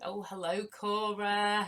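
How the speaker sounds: ordinary talk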